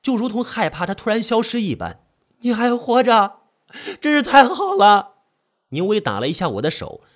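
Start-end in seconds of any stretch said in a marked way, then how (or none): 2.4-5.1 high, loud joyful cry; overjoyed. Acted. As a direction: burst out in relief. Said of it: "我激动地抱住她".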